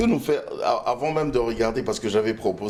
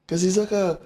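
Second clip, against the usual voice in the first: MC hype call; 9 semitones higher, faster than usual. Retold or imitated. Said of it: "ils disent, ah".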